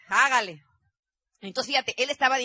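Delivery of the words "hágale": sigh, then a slow, reluctant "hágale"; resigned sigh. Retold or imitated. Wife giving in to her husband's optimism, resigned sigh. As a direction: give in with a weary sigh.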